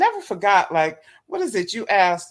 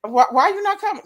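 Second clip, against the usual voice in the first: high pleading imitation; 11 semitones higher, 5 dB louder than usual. Voicing his pleading phone call.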